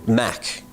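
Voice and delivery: "Mac" drawn out with a clear K at the end. Shown as a demonstration. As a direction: stress "Mac", landing on the final K. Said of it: "With this K".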